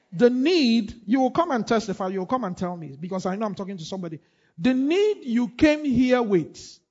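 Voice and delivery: loud, high fervent preaching; preaching at full force. Acted. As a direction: preach it out to the whole church.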